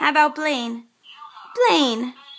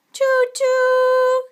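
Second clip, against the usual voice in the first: high sing-song train whistle choo-choo; 14 semitones higher, 3 dB louder than usual. Shown as a demonstration. Mother making a high train sound for Gavin. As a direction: toot it high like a toy train. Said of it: "train sound for child".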